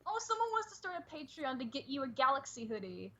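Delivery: ordinary talk, nothing marked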